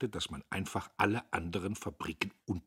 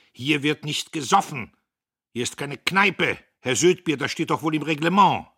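loud, higher furious scolding; angry yelling; 8 semitones higher, 12 dB louder than usual. A narrator's reading. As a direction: bark at the staff. Boss yells at workers.

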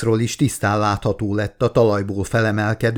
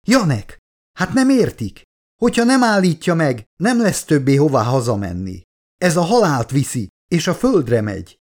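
growing despair; 6 semitones higher than usual. A narrator's reading. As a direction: plead desperately to be understood. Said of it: "esett kétségbe még jobban a doktornő". (kétségbeesett, magas hangú könyörgés)

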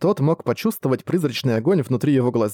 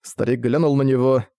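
plain reading voice

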